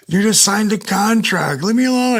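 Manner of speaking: high-pitched retold protest; imitated exasperated wife. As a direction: pitch it up as a fed-up spouse.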